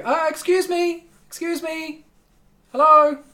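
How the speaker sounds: high calling voice, loud on hello; high female voice yelling to get attention; female guard calling out. Acted. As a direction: call after them as a guardswoman. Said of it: "a female voice yell out behind you".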